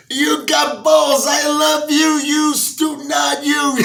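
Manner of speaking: high, slow mock mobster delight; amused gangsters. Mafia guys delighted by Pryor, high mock mobster voice. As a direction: gush like a grinning wise guy. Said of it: "They grabbed him by his head".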